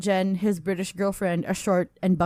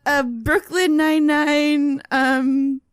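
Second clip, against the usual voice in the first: excited; 8 semitones higher, 6 dB louder, much slower than usual. Real, not acted. Reacting to listener's story submission.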